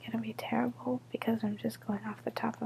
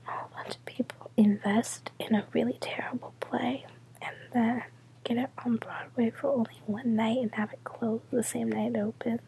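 whispering. Real, not acted. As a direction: whisper so nobody wakes. Whispers all night, midnight quiet.

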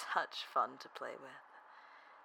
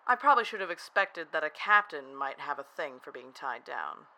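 ordinary delivery

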